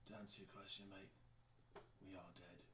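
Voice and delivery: very quiet, low-energy character voice; a faint, spent murmur from the undead; hushed undead reply. Acted. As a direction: answer softly, barely projecting. Game master voices dying undead figure.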